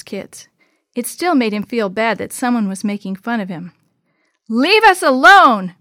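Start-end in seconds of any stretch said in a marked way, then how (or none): 4.5-5.7 loud, high, drawn-out shout; yelled. A narrator's reading. Maria yells at the teasing twins, loud shout. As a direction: shout them off, stretching the words. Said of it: "Maria yelled".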